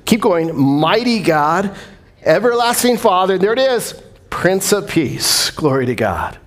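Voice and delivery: usual speaking voice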